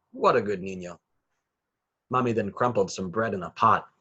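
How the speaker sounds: ordinary delivery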